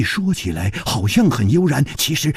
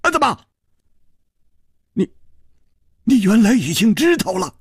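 high, faltering shock; dismayed sigh; 7 semitones higher, faster than usual. A narrator's reading. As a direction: stammer in high-pitched dismay. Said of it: "长叹道".

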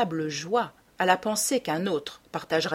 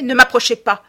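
loud, higher sudden command; sharp warning; 4 semitones higher, 10 dB louder than usual. Acted. Dorian stopping his devoted friend's approach, loud sharp warning. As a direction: cut in forcefully to stop him.

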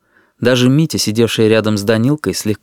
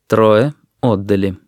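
usual speaking voice

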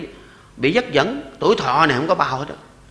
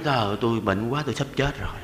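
lower, softer pretend weakness; mock feeble patient; 6 semitones lower, 8 dB quieter than usual. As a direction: fake being too sick to bother anyone. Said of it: "cái mình nói".

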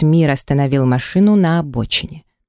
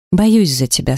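plain reading voice

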